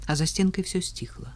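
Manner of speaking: usual speaking voice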